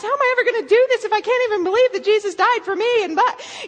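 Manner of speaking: high, fast, overwrought mock wail; imitated distraught girl. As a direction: imitate her spiraling into panicked despair. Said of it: "she's just a mess".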